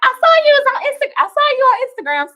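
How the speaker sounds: high, fast, excited friend's voice; imitating her friend. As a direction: squeal it like your girl spotting you online. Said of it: "She was like".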